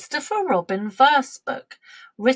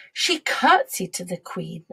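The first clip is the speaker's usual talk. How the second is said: ordinary delivery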